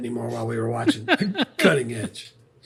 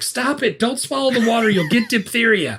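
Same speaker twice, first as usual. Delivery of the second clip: mock mom yelling; 7 semitones higher than usual. Mom warns kids in the water, high mock yell. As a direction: imitate an alarmed mom's yell. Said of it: "the mom is yelling".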